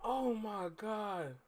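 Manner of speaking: low, slow, very soft; stunned near-murmur; hushed disbelief. Real, not acted. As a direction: sigh it out in quiet awe. Stunned, recovering from laughing.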